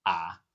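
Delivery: "Ah" dipping low, then lifting; low scooped "Ah"; third tone. Shown as a demonstration. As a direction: sink "Ah" down low before bringing it up. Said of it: "The pitch is dipping down, then rising".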